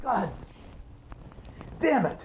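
sudden burst of pain; pained curse. Acted. Father in pain, cursing.

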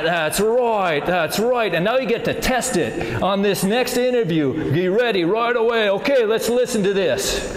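rally them in a put-on Australian accent. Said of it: "we kept the Australian accent".

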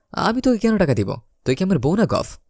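usual speaking voice

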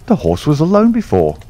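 Watson cries out, high and eager; a high, eager exclamation of discovery; excited cry. Acted. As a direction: blurt it out in discovery. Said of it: "I cried".

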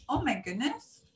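ordinary delivery

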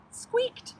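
high, loud squeak; squeaky. A narrator's reading. Narrator voices the squeaking fruit, squeakily. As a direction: squeak the word itself. Sound word acted in narration.

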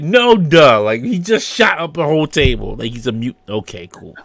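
loud, high sarcastic jeer fading to flat; mock the obvious, then shrug it off; sarcastic "no duh". Background guy mocking the crowd's shout, loud sarcasm. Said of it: "that one guy in the background".

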